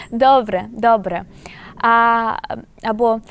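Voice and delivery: usual speaking voice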